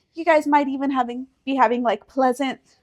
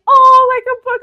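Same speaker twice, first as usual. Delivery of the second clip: high, loud gushing "oh"; mock-mom exclamation, pitched up; imitating touched mom; 11 semitones higher, 9 dB louder than usual. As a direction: gush like a sentimental mother. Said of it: "when she was like".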